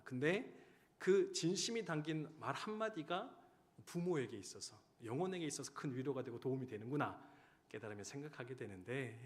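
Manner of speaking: usual speaking voice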